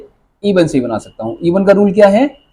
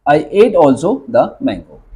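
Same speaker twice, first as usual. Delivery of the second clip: धीरे-धीरे; slow model sentence; 3 semitones lower, much slower than usual. Shown as a demonstration. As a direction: dictate slowly. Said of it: "इसके बाद क्या लगा देंगे?". (धीमा उदाहरण)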